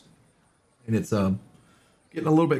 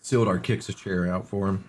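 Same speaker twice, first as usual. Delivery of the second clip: usual speaking voice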